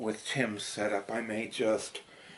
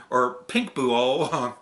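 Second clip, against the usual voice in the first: ordinary talk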